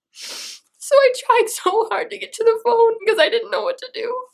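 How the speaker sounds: high, breaking voice, sobbing through words; words pushed out through real tears; crying. Real, not acted. Telling viewers she feared dying before her son, crying. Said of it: "I'm crying so bad".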